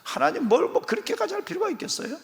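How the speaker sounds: very high, whiny, dismissive; Abraham sulking that he has no child; petulant Abraham. Acted. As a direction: pout and brush God off. Abraham mocked as sulky.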